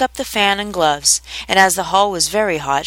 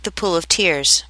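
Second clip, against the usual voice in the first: usual speaking voice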